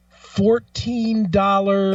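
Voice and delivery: very slow, drawn-out words as if writing a check; mock slow writing. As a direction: drag each word out like a slow customer. Mimics slow check writer.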